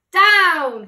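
loud sharp call of Down; cried out. Acted. Witch to her cat, a loud sharp cry. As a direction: witch yells to land the broom. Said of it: "cried the witch".

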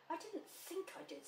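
high-pitched, worried little voice; anxious. A narrator's reading. Pooh answering Piglet's comfort, anxious. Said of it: "said Pooh anxiously".

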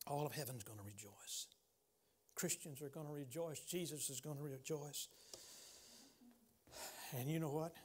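low, soft, tender appeal; soft altar call. Real, not acted. Preacher inviting the lost to return, soft and tender. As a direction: draw them in gently, almost hushed.

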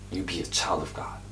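tender whisper; soft whispered blessing. Real, not acted. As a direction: whisper it close. Whispered exhortation.

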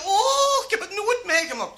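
high, loud opening "oh"; overwhelmed exclamation. Acted. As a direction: wail it in comic astonishment. Woman's shocked exclamation to doctor. (hoge, overdreven verbaasde uitroep)

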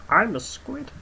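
high little creature voice; silly squid voice. Pretend. Gamer voicing a squid creature, high and cute.

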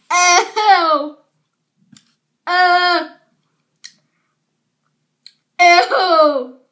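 loud pained groans of disgust. Real, not acted. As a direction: groan out at the sour taste. Tasting extreme sour candy.

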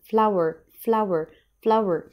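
flour said slowly, as /flawer/; slow model word. Shown as a demonstration. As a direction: give flour slowly for the class to repeat. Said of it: "Repeat after me". (نطق flour ببطء للتكرار)

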